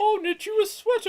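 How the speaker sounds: very high, loud put-on voice; Yarn Maiden. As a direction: squeal it as the silly knitting lady. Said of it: "knits to metal".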